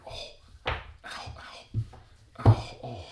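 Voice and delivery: sharp cries of pain; pained yelps. Acted. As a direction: yelp ow in pain. Cuts himself on knife.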